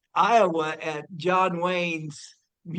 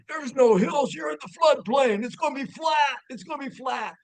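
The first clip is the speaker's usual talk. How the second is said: high-pitched mock shout; loudly overruling someone, voice jumping up high; insistent dad; 9 semitones higher, 3 dB louder than usual. Friend imitating the listener's dad arguing about hills, insistent mock shout. Dad's objection acted out in story.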